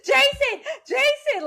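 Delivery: high, loud desperate scream of a name; screaming the answer. Retold or imitated. Terrified girl screaming the killer's name into the phone. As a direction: shriek it like your life depends on it. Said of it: "And she screams".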